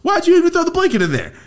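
high, loud squealed protest; comic high-pitched exasperation; exasperated complaint. Retold or imitated. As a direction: screech it in mock indignation. Acted exasperation over mom's detail.

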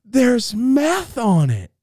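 high, loud, drawn-out announcement; hammed-up high-pitched warning; alarmed cry. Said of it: "she goes".

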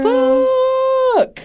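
high, loud impressed expletive; excited shout. Real, not acted. Listener blown away by the barrister's speech, shouting high and loud.

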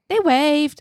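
put-on a child's cheerful report; a hint of a toddler answering mom.

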